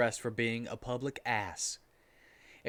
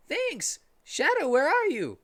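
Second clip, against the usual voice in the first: high, louder call; Tails calling; 13 semitones higher, 5 dB louder than usual. A narrator's reading. Tails calling out for Shadow, high and loud. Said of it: "said Tails".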